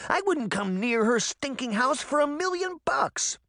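put-on high-pitched outraged girl; indignant protest. Sabrina scorning the witch's stinking house, indignant.

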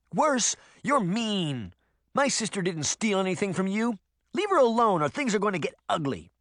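put-on high-pitched child's scolding; indignant little girl. Little girl warning the witch off her sister, indignant.

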